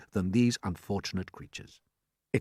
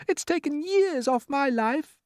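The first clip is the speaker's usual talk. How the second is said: high, slow dainty voice; ladybird; slower than usual. Acted. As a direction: sigh it out, prim and high. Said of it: "the ladybird said".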